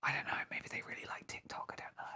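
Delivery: very soft, near-whispered musing; hushed doubtful aside. Real, not acted. Doubting whether the follower is real, hushed near-whisper. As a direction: say it under your breath, unsure.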